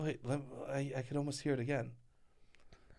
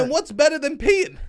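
excited; 13 semitones higher, 6 dB louder than usual. Pretend. Hammed enthusiasm.

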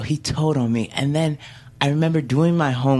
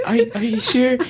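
very high, hesitant little voice; timid, squeaky disbelief; scared child. Retold or imitated. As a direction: imitate a frightened kid, stammering. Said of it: "I was just so scared".

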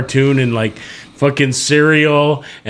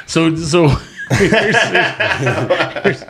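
laughing; 4 semitones higher, slower than usual. Real, not acted. Laughing while starting a story.

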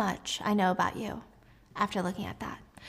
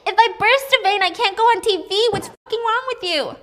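shrill outrage; 11 semitones higher, 7 dB louder than usual. Acted. Eve yelling after being choked.

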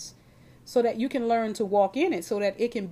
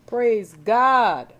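loud praise; 8 dB louder than usual. Real, not acted. Preacher's exclamation.

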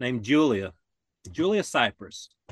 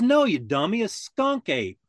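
higher, louder, exasperated correction; retold upset homeowner; 6 semitones higher, 3 dB louder than usual. Upset homeowner correcting the clueless deputy, exasperated. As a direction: imitate an irritated man setting someone straight. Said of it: "he was really upset by it".

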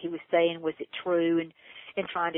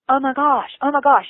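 higher, louder repeated oh my gosh; overwhelmed exclaiming; 7 semitones higher, 6 dB louder than usual. Retold or imitated. Realizing what cloning would mean, louder overwhelmed exclaiming. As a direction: let it spill out as it dawns. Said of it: "And I'm going".

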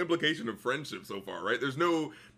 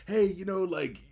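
lightly voiced pleading sister, higher pitch; mock conciliatory appeal, pitched up; imitated sisterly appeal; 6 semitones higher than usual. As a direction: coax a sister in a higher, put-on voice. Acts Gamora appealing to her sister.

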